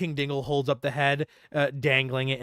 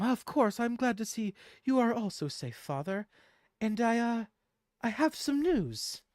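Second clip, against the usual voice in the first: higher gentle daughter; 8 semitones higher than usual. Acted. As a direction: lightly voice the tall daughter. GM voices the daughter.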